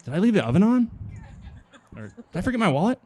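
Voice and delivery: high-pitched, sudden second thoughts; acted absent-minded animal, suddenly unsure; worried possum. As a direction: blurt high, swooping questions. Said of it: "he gets out there and he's like".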